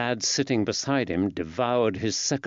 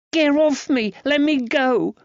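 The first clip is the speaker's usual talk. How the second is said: put-on frantic boy; 6 dB louder than usual. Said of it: "Georgie fought like a little demon".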